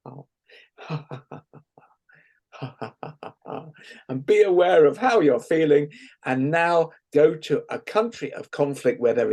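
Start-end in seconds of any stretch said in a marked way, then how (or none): 0.1-3.7 soft breathy ha-ha giggle, much softer; giggle jiggle. Shown as a demonstration. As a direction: giggle lightly under your breath. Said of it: "let's do a giggle jiggle again".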